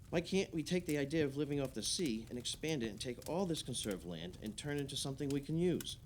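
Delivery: ordinary talk, nothing marked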